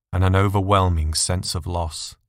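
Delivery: ordinary delivery, nothing marked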